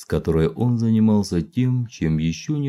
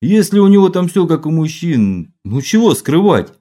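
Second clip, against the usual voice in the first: mock accusation; 8 semitones higher, 6 dB louder than usual. A narrator's reading.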